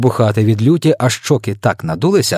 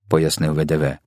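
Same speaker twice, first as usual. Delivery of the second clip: ordinary delivery, nothing marked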